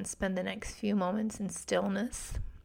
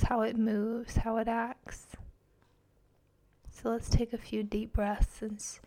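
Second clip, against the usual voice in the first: guided meditation; 4 dB quieter than usual. Acted. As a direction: guide them gently into calm.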